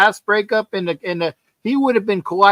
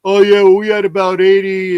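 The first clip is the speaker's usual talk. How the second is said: louder mock boast; imitating Jeff; 6 dB louder than usual. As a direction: brag it like Jeff, played up. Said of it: "Jeff was saying".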